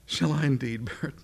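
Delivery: acted amused disbelief, about to chuckle; incredulous musing breaking into a chuckle; Sarah, chortling to herself. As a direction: start to laugh as you ask. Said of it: "kind of chortling to herself".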